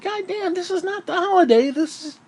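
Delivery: high, strained comic voice; a squeaky put-on complaint voice; mock exasperated rant. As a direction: whine it up high in disbelief. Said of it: "And I'm like".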